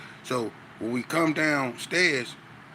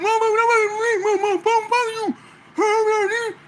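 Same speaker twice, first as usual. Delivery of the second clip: much higher, louder, fast put-on shouting voice; mocking a deaf man's rant; 8 dB louder, faster than usual. Deaf inmate cussing the teller for reading him, high mock shout. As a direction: rattle it off high and loud like the deaf guy. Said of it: "he sound horrible".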